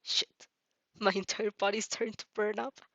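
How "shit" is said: usual speaking voice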